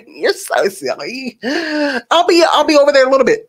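high, giggly mock-flirting voice; hammed-up clueless admirer; goofy creep. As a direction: play him oblivious and silly, speeding up at the end.

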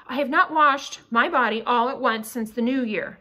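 plain reading voice

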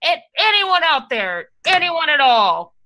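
high, drawn-out holler into the distance; yelling out. Acted. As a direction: call out for anyone who might hear. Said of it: "so I just start yelling like".

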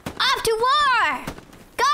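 high drawn-out rallying call; rally the troops, slowly; battle cry. Pretend.

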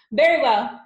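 booming, high-pitched and loud; big commanding headmistress boom; Trunchbull. A narrator's reading. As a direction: boom it out imperiously. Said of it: "boomed the Trunchbull".

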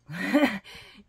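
loud short laugh; burst of laughter. Real, not acted. Amused at the meditation cutting off, loud laugh.

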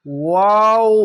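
লাম held long and slow; drawn-out letter name. Shown as a demonstration. Teacher showing students the long letter, drawn out. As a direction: stretch লাম three alif. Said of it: "এখানেও তিন আলিফ টেনে পড়বেন".

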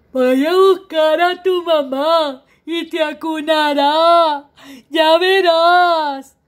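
slow, loud, drawn-out big cat promise; smiling tiger. Acted. Tiger promises the baby to fetch mom, slow and booming. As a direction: boom it slowly with a grin. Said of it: "le contesta Tigre sonriente".

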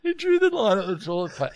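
high laughter through the words; laughing. Real, not acted. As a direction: crack up while talking. Cracking up at the joke.

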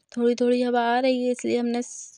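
ordinary talk, nothing marked

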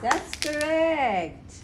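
high, stretched-out sing-song praise. Real, not acted. Mother praising baby's answer, sing-song baby talk.

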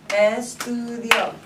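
ESTUDIO slowly sounded out in syllables. Shown as a demonstration. Teacher helping students count syllables, ESTUDIO slowly sounded out. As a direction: stretch each part of "Estudio" evenly. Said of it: "how many syllables are in it".

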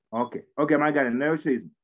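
ordinary talk, nothing marked

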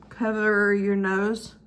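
normal talking voice

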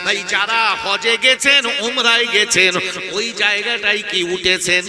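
very loud, half-sung preacher's delivery; full-force preaching. Acted. Preacher retells the Prophet and Abu Bakr to the congregation, loud chanted preaching. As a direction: belt out the holy story with long drawn-out line ends.

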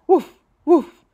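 high, loud double bark; dog barks. Acted. The dog Manny barking hello at the bakery, loud. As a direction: bark twice like an eager small dog.

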